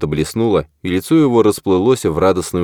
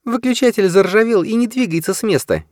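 unruffled, higher female voice; calm woman's voice. A narrator's reading. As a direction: keep it cool and level, higher. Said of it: "невозмутимо продолжила Уошборн".

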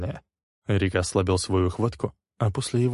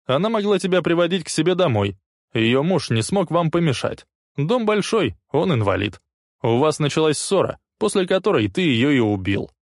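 heated accusation; 8 semitones higher, 4 dB louder than usual. A narrator's reading. Leslie lays out accusation heatedly.

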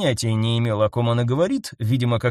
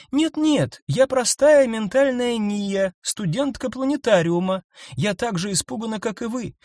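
frightened creature pleading; 9 semitones higher than usual. A narrator's reading. Nyasha timidly pleads her innocence to the group. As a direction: beg them to believe you.

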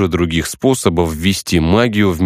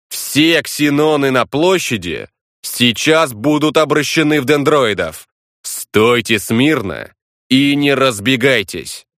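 commanding; 7 semitones higher than usual. Acted. Bluffing command to the crowd.

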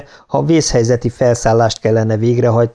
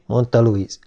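ordinary delivery, nothing marked

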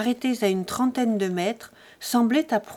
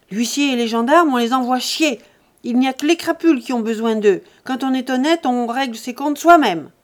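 loud, higher, fast scorn; contemptuous shout; 3 semitones higher, 7 dB louder than usual. A narrator's reading. Buteau mocking bailiffs and gendarmes, angry shout. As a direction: sneer at the law loudly.

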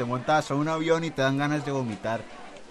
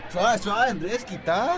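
calling out; 4 semitones higher, 5 dB louder, slower than usual. Acted. Friends breaking up a mock fight.

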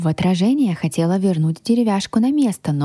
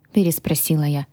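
usual speaking voice

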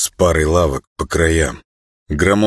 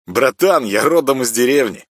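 proud dignity; 7 semitones higher than usual. Acted. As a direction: speak like a proud prince. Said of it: "с достоинством рождённого во дворце принца". (с достоинством принца)

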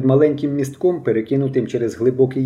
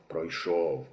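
тихий, нижчий голос старого; кинь неохоче, похмуро; 8 semitones lower, 9 dB quieter than usual. A narrator's reading. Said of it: "похмуро промовив старий". (старий тихо й похмуро відповідає)

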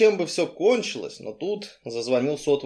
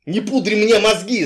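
high, raised exasperated outburst; loud insults with pitch leaping; angry yelling; 7 semitones higher, 5 dB louder than usual. A narrator's reading. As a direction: yell it in exasperation. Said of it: "заорал он".

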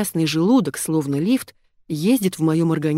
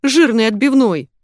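loud, high groan of disgust; queasy, raised-voice moan; 6 semitones higher, 7 dB louder than usual. A narrator's reading. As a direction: wail it as if about to be sick. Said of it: "простонала я".

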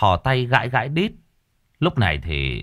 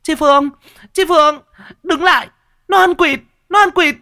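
put-on much higher and louder, shrill yelling girl; shrieking for help; 7 dB louder than usual. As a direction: scream after him to the boss. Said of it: "mồm kêu oái oái".

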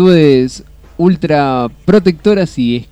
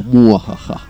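low evil villain cackle; mustache twirling villain; 5 semitones lower, slower than usual. Pretend. Spooky horror film title. (risa malvada de villano)

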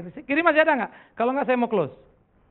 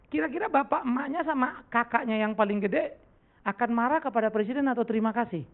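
ordinary talk, nothing marked

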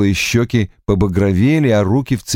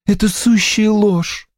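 exclaiming; 11 semitones higher than usual. A narrator's reading. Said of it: "воскликнула Анна".

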